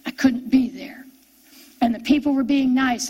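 ordinary talk

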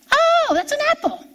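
put-on high, far-off little child voice; child's voice. A kindergartner answering the teacher in a child voice. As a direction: pipe up like a small child.

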